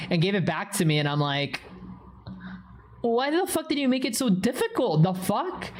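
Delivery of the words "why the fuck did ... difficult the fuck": high, incredulous complaint; mock exasperated outburst; acted exasperation. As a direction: replay your baffled frustration, pitched up. Acts own exasperated reaction in story.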